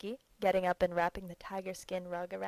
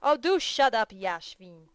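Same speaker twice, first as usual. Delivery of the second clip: furious; 3 semitones higher, 11 dB louder than usual. A narrator's reading. Petritsky yells at Yashvin, who is pulling off his rug, furious and loud. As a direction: snap at him to stop. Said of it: "getting furious with Yashvin".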